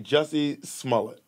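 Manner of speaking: "Smollett" said as SMALL-it; English-style Smollett. Shown as a demonstration. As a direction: end "Smollett" on a short "-it". Demonstrates own pronunciation before rival's.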